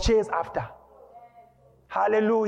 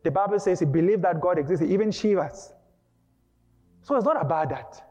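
ordinary talk

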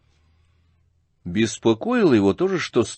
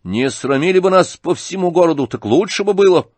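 high, loud reproach; angry shout; 8 semitones higher, 6 dB louder than usual. A narrator's reading. Ганя accusing his father of shaming them, angry shout. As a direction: reproach him loudly in raised pitch. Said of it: "крикнул Ганя".

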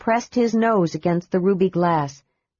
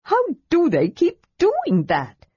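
high, slow exasperated kender; frustrated demand; 9 semitones higher than usual. A narrator's reading. Tass fuming at doors that open for others, frustrated. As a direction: throw up your hands at the doors. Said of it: "Tass demanded, frustrated".

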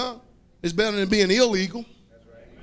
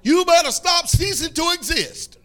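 higher, louder, drawn-out preaching; full-force preaching; 7 semitones higher, 7 dB louder, slower than usual. Acted. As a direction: hammer the words home to the congregation.

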